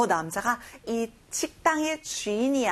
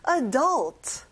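adult with the second syllable punched, falling; stress on adult. Shown as a demonstration. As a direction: hit the "dult" in adult firmly. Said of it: "adult에 강세를 넣어주세요". (adult 둘째 음절에 강세)

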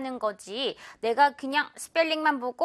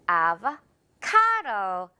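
avocado with ca hit hard and stretched, very slow; stress on ca; 2 semitones lower, much slower than usual. Shown as a demonstration. Teacher drilling the stress on ca, slow and deliberate. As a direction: lean on ca and drag the word. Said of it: "카 부분 이렇게 확실하게".

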